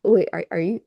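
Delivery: ordinary talk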